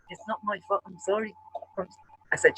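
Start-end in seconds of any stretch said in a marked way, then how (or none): 0.1-1.3 meek apology. Retold or imitated. Paddy's meek apology quoted.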